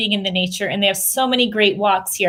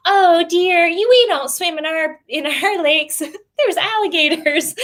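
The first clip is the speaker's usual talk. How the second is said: mock gentle warning, pitched up; Florida shop lady; 7 semitones higher than usual. Mom imitates the Florida store lady warning about alligators, higher mock voice. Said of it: "And she's like".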